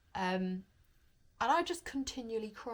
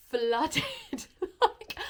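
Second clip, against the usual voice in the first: real laugh breaking into the words; laughing while overwhelmed with happy tears; laughing through tears; 6 semitones higher, 6 dB louder than usual. Real, not acted. Vlogger retelling crying after hospital, laughing through it.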